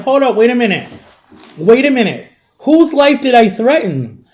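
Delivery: indignant disbelief. Retold or imitated. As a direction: challenge the accusation, drawing out the final question. Retold protest at being expelled.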